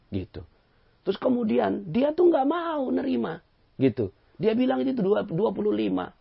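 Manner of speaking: very high, loud pleading; stubborn men complaining loudly to the judge; high, agitated complainer. Retold or imitated. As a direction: whine to the judge.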